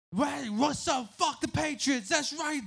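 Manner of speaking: high, slow loud heckling; drunk taunting. Retold or imitated. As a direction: drunk fans jeering. Drunk fans taunting him.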